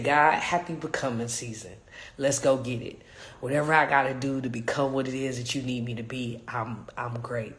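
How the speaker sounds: low, hushed talk to God; soft prayer, sinking to a near whisper at the end; retold quiet prayer. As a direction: murmur it like a private morning prayer. Retells morning prayer to God.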